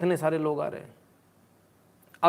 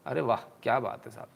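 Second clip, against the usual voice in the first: ordinary talk, nothing marked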